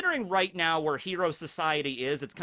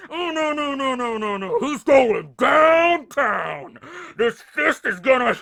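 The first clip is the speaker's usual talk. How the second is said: high, laughing, shouting eager voice; gleeful battle cry; 8 semitones higher, 9 dB louder, slower than usual. Retold or imitated. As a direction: cackle and yell, itching to fight. Izuku eager to smash Tomura.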